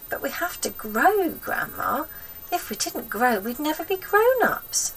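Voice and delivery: put-on timid boy pleading with his gran. George voiced in story.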